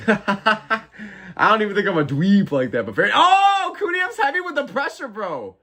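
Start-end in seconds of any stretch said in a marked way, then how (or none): 3.1-5.6 hyped reaction, pitched way up; excited. Real, not acted. Streamer hyping a fighter's flurry, excited. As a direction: yelp it out like a fan watching a flurry.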